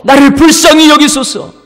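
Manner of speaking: undeterred cry. Acted. Blind beggar ignoring the scolding, crying out earnestly. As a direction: cry for mercy over the rebukes. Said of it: "꾸짖음에도 불구하고".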